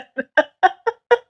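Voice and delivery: high, squeaky laughing fit; helpless laughter. Real, not acted. Laughing after tripping over her words, high giggling fit. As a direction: crack up at your own stumble.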